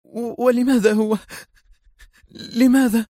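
widow-like anguish, asking why him; crying out. A narrator's reading. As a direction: cry out in grief. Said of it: "انفجرت باكية في عنف وهي تهتف".

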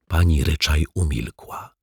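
ordinary delivery

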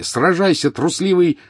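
high furious shout. A narrator's reading. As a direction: scream it at the coward. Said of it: "крикнул Гарри".

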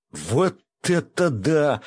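high, drawn-out exclamation; exclaiming in awe. A narrator's reading. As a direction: revel in the rush of power. Keeper marvels at the received power. (протяжный восторженный возглас)

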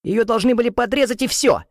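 put-on high, squeaking girl voice; squeaky. A narrator's reading. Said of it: "Почти пищит Адриана".

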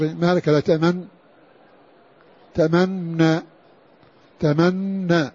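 تأمن with lip rounding heard on the doubled nun; إشمام مصاحب للنطق; ishmam voiced with the nun. Shown as a demonstration. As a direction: round the lips while saying the nun of تأمن. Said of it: "بل هو مصاحب للنطق".

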